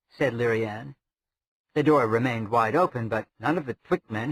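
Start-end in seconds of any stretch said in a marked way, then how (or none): none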